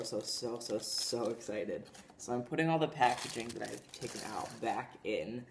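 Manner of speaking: ordinary talk, nothing marked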